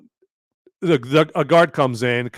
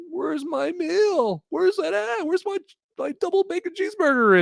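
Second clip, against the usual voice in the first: very high, thin mock whimper; imitating crying Edward. Condemned man asks guard for his meal, mock crying. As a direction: whimper it high, hammed-up tearful. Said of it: "he's crying 'cause he's obviously".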